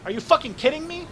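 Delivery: disbelief. Acted. Wu breaks down in disbelief.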